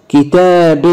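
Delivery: كتاب as kitaabin; kasra tanween. Shown as a demonstration. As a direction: end كتاب in "bin". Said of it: "تنوين بالكسر".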